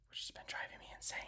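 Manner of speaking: whispered aside, very soft and quick; exasperated whisper. Real, not acted. Streamer venting about the internet to viewers, exasperated whisper. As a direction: mutter it quickly under your breath.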